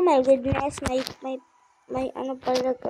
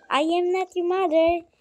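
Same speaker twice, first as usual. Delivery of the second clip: sassy; 5 semitones higher, 6 dB louder than usual. Shown as a demonstration. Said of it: "English bigla ang auntie nyo".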